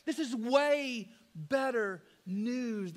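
ordinary talk, nothing marked

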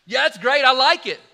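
second time, let the delight explode; delighted loud repeat. Retold or imitated. Diner owner delighted by the birthday party plan, loud and excited.

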